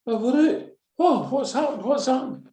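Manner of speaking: high, stunned cry; shocked disbelief. Retold or imitated. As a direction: pitch up in disbelief at the goal. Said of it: "I'm like".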